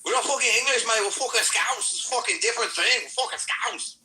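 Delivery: high, rowdy Scouse accent; Liverpool Scouse accent. Shown as a demonstration. Showing friends his Liverpool accent, indignant high Scouse rant. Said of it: "I can actually do Liverpool pretty good".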